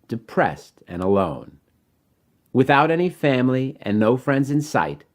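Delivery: measured rhyming storybook read over music; story narration. Acted. Author reading his rhyming book to children, gentle storytelling. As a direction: read it like a bedtime picture book.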